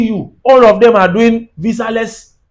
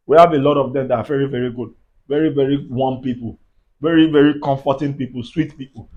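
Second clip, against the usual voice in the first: much lower and softer, gentle praise; softer, lower warmth; 9 semitones lower, 6 dB quieter than usual. Real, not acted. Praising the good South Africans, lower and softer. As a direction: ease right down, speak kindly of them.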